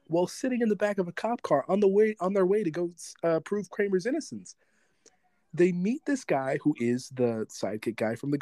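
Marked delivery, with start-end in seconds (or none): none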